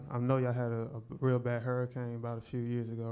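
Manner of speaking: normal talking voice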